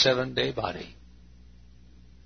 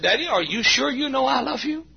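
much higher, pleading daughter's voice; imitating daughter; 3 dB louder than usual. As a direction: echo a daughter asking for reassurance. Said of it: "She said".

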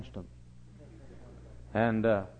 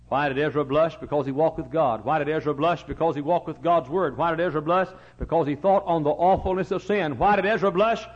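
climbing higher and louder each round; building preaching refrain; 4 semitones higher, 7 dB louder than usual. Real, not acted. Building refrain, rising intensity.

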